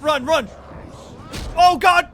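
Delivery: panicked yelling while fleeing; frantic screams, running for life; being chased. Real, not acted. Chased by monster in game.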